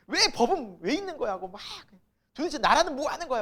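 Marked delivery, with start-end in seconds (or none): 0.1-1.3 high, loud indignant complaint; mock angry outburst. Preacher mimicking people angry at the law, mock indignation. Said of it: "막 화가 나가지고". 2.3-3.4 high, louder indignant grumble; mock angry outburst. Preacher mimicking people angry at the country, mock outrage. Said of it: "막 분노하지 마시라는 거예요".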